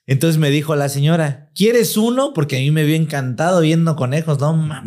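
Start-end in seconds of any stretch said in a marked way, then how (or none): 1.6-2.3 lightly voiced, high sweet offer; lady's offer. Lady offering the boy a rabbit, high and sweet.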